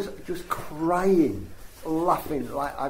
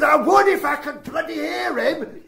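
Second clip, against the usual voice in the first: higher, louder cry through laughter; exasperated shout; 6 dB louder than usual. Retold or imitated. The woman in the car complaining she can't hear, laughing shout. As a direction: shout it as the fed-up passenger.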